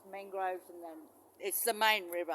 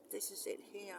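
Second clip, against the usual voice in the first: soft whisper; 8 dB quieter than usual. Real, not acted. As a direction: keep it hushed. Creeping close with the camera.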